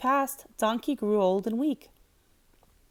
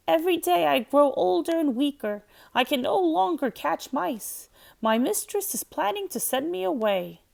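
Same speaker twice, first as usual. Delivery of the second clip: put-on higher, sad old cat; 6 semitones higher than usual. A narrator's reading. Cat tells Donkey her woes, higher sad voice. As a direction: pitch it up as a weary old cat.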